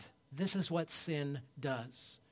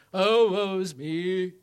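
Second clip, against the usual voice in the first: loud, drawn-out mock lament; hammed-up "poor me" wail, slow; mock self-pity; 4 semitones higher, 12 dB louder, much slower than usual. Preacher mocking walking around in sorrow, hammed-up lament. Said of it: "hitting ourselves with the board in the head saying".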